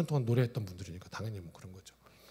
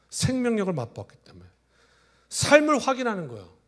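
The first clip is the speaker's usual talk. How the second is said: high, loud, slow proclamation; preaching at full force; 11 semitones higher, 8 dB louder than usual. Acted. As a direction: drive each phrase home slowly. Preacher's emphatic climax.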